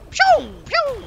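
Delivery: high-pitched "pew, pew"; playful high zap sounds; weapon noise imitation. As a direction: squeak out little laser shots.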